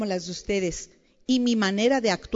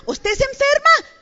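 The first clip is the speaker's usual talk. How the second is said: emphatic cry; 11 dB louder than usual. Real, not acted. Preacher's emphatic outburst.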